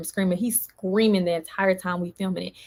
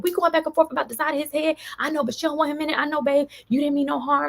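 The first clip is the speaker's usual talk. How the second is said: imitating Jeremy; 8 semitones higher than usual. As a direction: play the boyfriend, higher and hurried. Jeremy voiced in retold argument.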